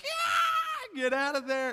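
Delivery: very high, loud shout; high, loud excited shout; excited yell. Retold or imitated. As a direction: yell it like a scared kid at the movie. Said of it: "and I was like".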